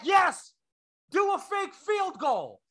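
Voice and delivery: high, drawn-out shouted answer; coach yelling back. Acted. Coach answering his player across the field, yelling back. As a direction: holler the order back, slow and high. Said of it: "he yelled back".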